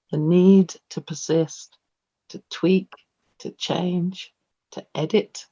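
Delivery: slow, measured verse reading with drawn-out words; poem recital. Shown as a demonstration. Poet reading out her own stanza, measured recital. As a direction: recite slowly, pausing between phrases, fading to the end. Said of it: "looking at down now at a stanza".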